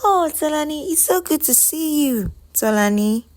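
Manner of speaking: high, lively welcome; delighted greeting. Acted. Ego catching sight of her friend Tolani, delighted. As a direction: greet a dear friend in a lifted, excited voice.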